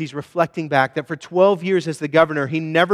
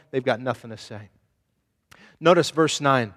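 normal talking voice

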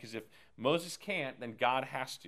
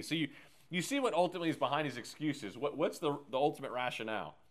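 ordinary talk

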